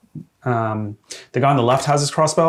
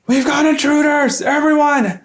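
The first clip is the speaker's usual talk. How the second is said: high, strained alarm shout; bandit yelling; 5 dB louder than usual. Acted. Bandits alerting everyone to intruders, high hammed-up yell. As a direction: raise the alarm at the top of a squeezed high voice. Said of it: "they basically yell out".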